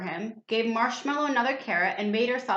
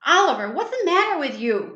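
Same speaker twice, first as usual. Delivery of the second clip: higher, louder call to the cat; cried out; 7 semitones higher, 5 dB louder than usual. A narrator's reading. Miss Tilly crying out to her frightened cat, louder. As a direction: call out in surprise. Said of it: "cried Miss Tilly".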